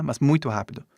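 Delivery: ordinary delivery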